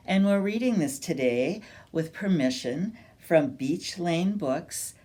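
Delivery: ordinary delivery, nothing marked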